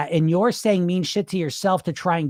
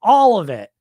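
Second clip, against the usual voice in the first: louder tempting inner voice; a louder inner voice urging eat all of it; 6 dB louder than usual. Retold or imitated. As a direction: play the voice in your head that pushes you to eat all of it. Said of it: "the voice pops in and says".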